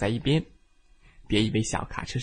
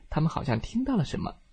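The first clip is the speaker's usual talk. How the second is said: usual speaking voice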